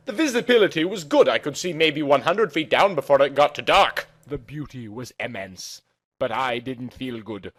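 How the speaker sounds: ordinary delivery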